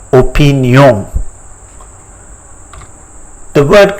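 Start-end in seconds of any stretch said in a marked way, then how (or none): 0.1-1.1 "opinion" as French o-pee-nyon, nasal ending; French pronunciation. Shown as a demonstration. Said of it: "but it is pronounced as".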